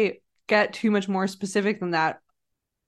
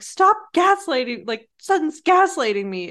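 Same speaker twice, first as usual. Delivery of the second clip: high-pitched mock protest; indignant protest; 6 semitones higher, 3 dB louder than usual. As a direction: imitate someone affronted, pitch jumping up. Voicing Kyle's protest.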